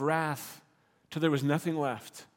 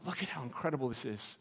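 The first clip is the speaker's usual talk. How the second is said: soft awe; 4 semitones lower, 10 dB quieter, faster than usual. Pretend. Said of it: "we go".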